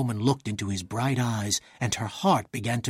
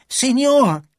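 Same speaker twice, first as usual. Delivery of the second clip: high, loud urgent cry to his master; panicked servant calling out high and loud; alarmed cry; 12 semitones higher, 7 dB louder than usual. A narrator's reading. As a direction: burst in crying out the warning. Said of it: "he cried".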